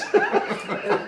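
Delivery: shrieking laugh; high burst of laughter. Real, not acted. Telling a funny shop story, cracking up. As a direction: let the laugh break out high.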